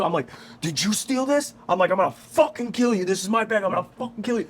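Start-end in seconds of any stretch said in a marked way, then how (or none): 0.6-1.5 high, heated mock demand; acted angry accusation. Acting out angry confrontation. 1.9-4.5 high, heated mock threat; imitated rage in a high voice; acted furious threat. Acting out threat to suspected thief.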